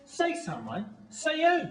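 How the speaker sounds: high-pitched, indignant echo; incredulous retort. Acted. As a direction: throw it back in disbelief. Acted retort in couple's quarrel.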